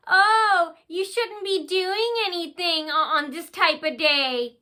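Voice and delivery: high, slow mock-concerned old lady voice. Imitating an old lady stalking her in the bank. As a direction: drawl it out like a fussy old woman. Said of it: "She goes".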